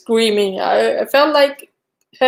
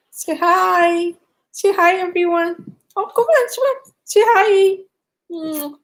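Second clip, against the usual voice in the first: pet talk; 7 semitones higher than usual. Real, not acted. Urging her dog to say hi to viewers, high cooing baby talk. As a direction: coo at the dog to get her to greet everyone.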